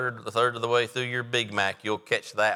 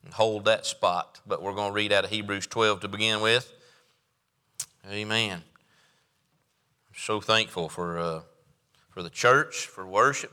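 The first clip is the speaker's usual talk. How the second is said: ordinary talk, nothing marked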